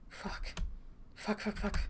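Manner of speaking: hushed, near-whispered swearing; muttered cursing. A narrator's reading. Character swearing to himself after throwing down his cape, muttered. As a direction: curse under your breath. Narrator's character curses under breath.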